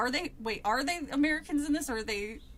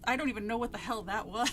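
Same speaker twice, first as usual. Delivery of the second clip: usual speaking voice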